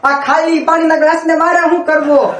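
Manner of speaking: mock stern teacher. Teacher scolds the boy.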